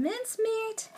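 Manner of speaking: very high squeaky mincemeat; squealed. Real, not acted. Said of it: "repeats word".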